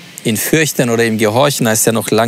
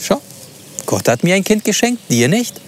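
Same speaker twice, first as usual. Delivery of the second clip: gloating and higher, rubbing it in; mocking boast; 6 semitones higher than usual. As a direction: taunt her with God's gift. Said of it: "und gesagt wird".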